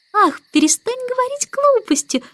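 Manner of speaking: put-on very high, childlike whimpery protest; whining. A narrator's reading. Baby begs the starling to stop, high-pitched whining. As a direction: whine it as a fretful tiny girl. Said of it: "захныкала Аннабелла".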